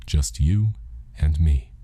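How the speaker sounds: usual speaking voice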